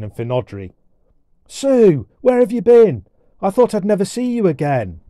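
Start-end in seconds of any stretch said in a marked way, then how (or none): 1.5-5.0 put-on high, loud, relieved boy; overjoyed cry. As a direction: call out to a lost sister. Boy finds his lost sister.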